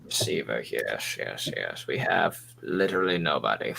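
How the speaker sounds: low, soft, proper English gentleman; Sherlock Holmes voice. Acted. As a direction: play the polite English butler, hushed. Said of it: "What's with the Sherlock Holmes shit?".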